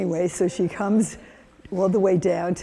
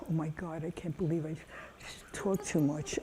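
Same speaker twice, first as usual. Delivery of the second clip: muttered under the breath, much softer; self-chiding aside; 2 semitones lower, 12 dB quieter than usual. Real, not acted. Speaker to herself for talking too much, quiet aside. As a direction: drop right down and scold yourself quietly.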